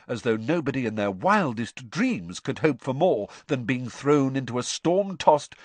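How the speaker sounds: plain reading voice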